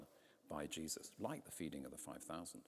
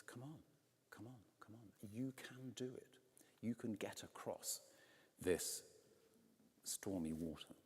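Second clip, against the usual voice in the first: gentle coaxing; 2 semitones higher than usual. Acted. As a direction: coax them across like a patient guide. Jesus coaxing the faithful softly.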